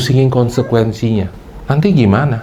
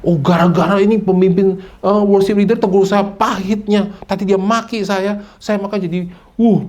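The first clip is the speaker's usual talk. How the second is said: excuse-making whine; 7 semitones higher than usual. Retold or imitated. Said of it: "Jangan bilang".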